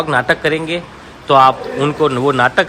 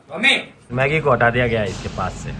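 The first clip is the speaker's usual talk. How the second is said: ordinary talk, nothing marked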